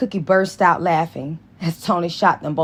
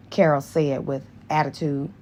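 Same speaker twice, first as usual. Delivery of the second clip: usual speaking voice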